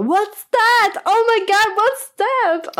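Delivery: very high, loud mock shriek; imitated screaming. Onlooker spotting the pad in the retold story, mock screaming. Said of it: "hade typ bara skrikit".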